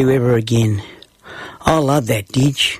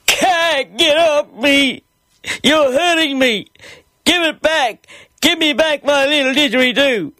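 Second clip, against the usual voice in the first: put-on much higher child's voice, pleading and slowed; little boy crying out; 13 semitones higher than usual. A narrator's reading. Small Johnny crying out to his big brother, pleading child voice. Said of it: "I cried out".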